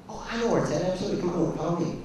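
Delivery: high-pitched, obliging shop clerk; eagerly helpful. Shown as a demonstration. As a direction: jump in gladly to help the customer. Demonstrates eagerly helping a bookstore customer.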